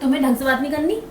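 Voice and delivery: normal talking voice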